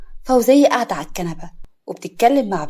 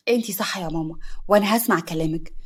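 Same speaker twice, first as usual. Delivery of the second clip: ordinary delivery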